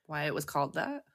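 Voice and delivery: ordinary delivery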